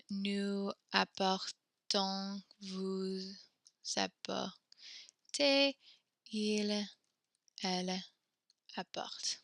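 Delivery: very slow, soft, word by word; drawn-out typing speed; slow dictation while typing. Shown as a demonstration. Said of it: "dictating while typing".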